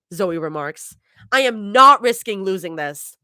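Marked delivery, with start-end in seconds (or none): 1.3-3.2 insistent. A narrator's reading. Zoe insists.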